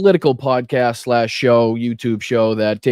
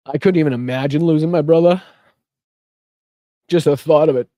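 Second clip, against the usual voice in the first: fighting back emotion, long pauses between phrases; choked up; 3 semitones higher, faster than usual. Real, not acted. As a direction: push through as the thought gets to you. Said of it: "just got me".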